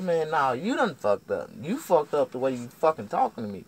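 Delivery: loud, high counter-accusation; heated comeback. Retold or imitated. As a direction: throw the blame back in an acted quarrel. Acted retort in quarrel.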